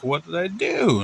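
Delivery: high, drawn-out puzzled protest; bewildered boy. Acted. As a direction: play a confused kid, slow and rising in pitch. Bewildered child protests to teacher.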